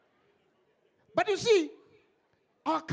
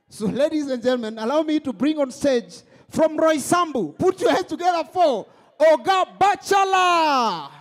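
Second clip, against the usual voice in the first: slow, rising announcer call that ends in a loud shout; announcer build-up; 4 semitones higher, 11 dB louder than usual. Acted. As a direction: hype up the crowd, building to a yell. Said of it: "introducing the next comedian".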